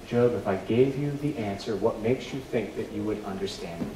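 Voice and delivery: soft repetition. Acted. Repeating God's words, softer.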